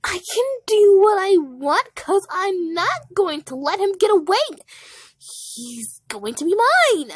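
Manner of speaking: high, loud bossy defiance; possessive defiance. Acted. Blackberry refusing Pap's advice, high and loud. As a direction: insist on it like a spoiled boss.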